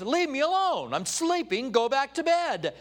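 mock-irritated dismissal; annoyed sleeper. Woken Eli sends Samuel away.

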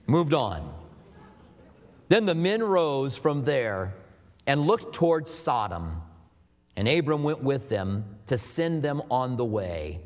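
ordinary talk, nothing marked

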